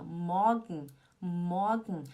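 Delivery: MORGEN as "MAW-gn", ending swallowed; slow "morgen" with no R; swallowed sound. Shown as a demonstration. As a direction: gulp down the end of morgen. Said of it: "Parece que você tá engolindo alguma coisa".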